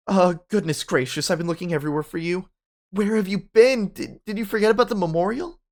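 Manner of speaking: high, loud reprimand, rushing; high-pitched exasperated principal voice; shrill scolding principal. Acted. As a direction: scold him like a fussy headmistress. Principal scolds student on stage.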